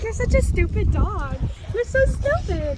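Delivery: mock sweet talk. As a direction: imitate someone sweet-talking a dog. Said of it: "He was being sweet".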